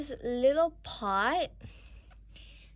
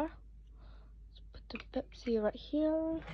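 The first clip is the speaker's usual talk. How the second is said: whispering; 4 semitones higher, 7 dB quieter than usual. Real, not acted. Whispering while placing the Pepsi.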